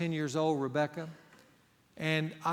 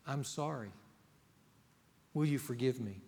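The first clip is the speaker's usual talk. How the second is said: softer sincere request; gentle apology; 3 semitones lower, 3 dB quieter than usual. Retold or imitated. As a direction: offer it humbly. Model apology phrases.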